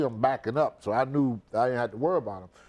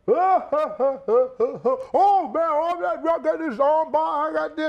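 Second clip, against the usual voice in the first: much higher mocking parody voice; mocking wrestlers; 13 semitones higher, 4 dB louder than usual. Retelling wrestlers' bragging to the host, high mocking parody. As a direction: mimic them bragging, high-pitched. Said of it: "they talking about they freaking match".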